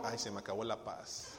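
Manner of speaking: low, soft grumble of defeat; mock groan. Husband grumbling softly as his wife walks in. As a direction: sigh it out, peace ruined.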